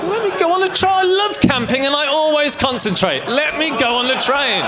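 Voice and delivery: put-on very high, childlike begging; hammed-up high-pitched plea; mock pleading. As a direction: insist like an over-eager child. Childlike begging to board train.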